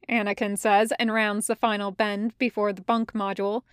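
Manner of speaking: ordinary delivery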